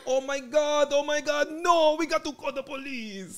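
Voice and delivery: high, frantic lady voice, slowing at the end; hammed-up high alarmed woman; neighbor lady's accent. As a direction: squeal like the shocked homeowner. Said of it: "Oh, with that accent?".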